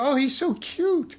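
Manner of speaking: high, slow, gushing fan voice; mocking a gushing female fan; mock cooing fan. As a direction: coo it like a smitten fan imitating women. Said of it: "They say it's cute".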